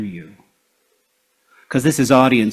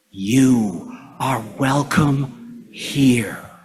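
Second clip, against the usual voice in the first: slow, tender, near-whispered welcome; with love; 4 dB quieter, much slower than usual. Shown as a demonstration. Leader telling the congregation to say it with love, tender hush. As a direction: breathe it out slowly and lovingly. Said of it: "Say it with love".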